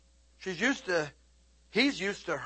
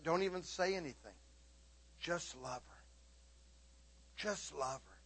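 gentle voice of God; 14 dB quieter than usual. Retold or imitated. God quietly telling the preacher to just love her, soft. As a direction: speak it softly, like a gentle voice within. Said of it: "And God's like".

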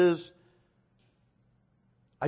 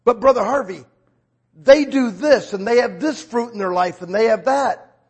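louder, higher mock objection; voiced protest; 5 semitones higher, 8 dB louder than usual. Congregant objecting to Brother Harvey about other ministries, insistent protest.

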